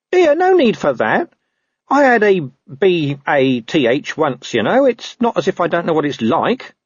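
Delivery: high-pitched, protesting dog voice; indignant talking dog. Acted. Dog defends his washing habits to Carrot, indignant talking dog. Gaspode objects about bathing.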